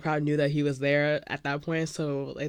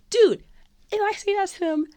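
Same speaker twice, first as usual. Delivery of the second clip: high, emphatic squeal; worked-up insistence; 14 semitones higher, 6 dB louder than usual. Retold or imitated. Fan insisting to her doubting friend, worked-up and high. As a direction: insist at a high, excited pitch.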